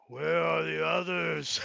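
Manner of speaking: slow, put-on giant voice; giant's voice. Giant stops outside looking for the others, slow put-on voice. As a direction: drag the words out as a lumbering giant.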